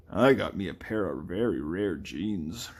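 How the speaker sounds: slow put-on boast; game character voice. Game character showing off jeans, slow put-on voice. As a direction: draw it out in a character voice.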